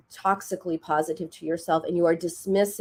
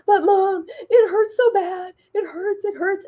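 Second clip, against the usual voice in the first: mock crying child; 12 semitones higher, 4 dB louder than usual. Daughter still crying to mom about scraped knee, imitated wail. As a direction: whine to Mom like a hurting kid. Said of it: "she keeps crying".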